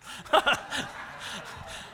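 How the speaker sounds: airy silent laugh; breathy laugh. Real, not acted. Pastor laughing at the joke, soft breathy laugh.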